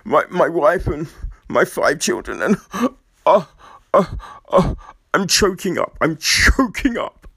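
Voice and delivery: mock choked-up speech, gasping and halting; fake tears. Imitating an actor thanking his family, fake tearful choking up. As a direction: badly fake being overcome, breaking between phrases. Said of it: "sometimes it's the fake tears".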